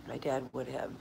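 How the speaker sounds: very soft, low and sad; grieving, almost to oneself; tearful. Real, not acted. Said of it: "I'm crying so much".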